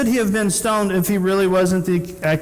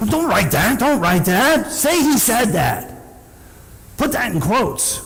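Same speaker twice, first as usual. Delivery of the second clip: higher, hurried objection; religious leaders running up to Pilate, lightly voiced alarm; flustered protesting leaders; 7 semitones higher, slower than usual. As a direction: beg him to change the sign. Leaders rush to protest sign.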